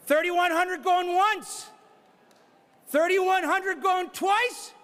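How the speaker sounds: brisk auctioneer patter pushing for the next bid; auctioneer bid calling. Real, not acted. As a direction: call the bids like a charity auctioneer. Auctioneer running live bidding.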